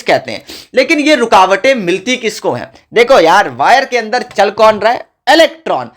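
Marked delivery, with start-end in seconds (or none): none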